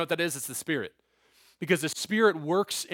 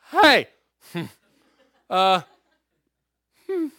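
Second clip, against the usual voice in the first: nervous loud hey; 3 semitones higher, 8 dB louder, slower than usual. Retold or imitated. Awkward invite acted out.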